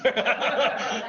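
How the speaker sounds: a loud, real burst of laughter at his own joke; hearty laugh. Real, not acted.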